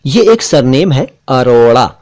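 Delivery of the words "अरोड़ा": अरोड़ा said with a tongue-flicked ड़ in the middle; अरोड़ा, flap the ड़ sharply; clear retroflex flap. Shown as a demonstration. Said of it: "अरोड़ा ही बोला करिए".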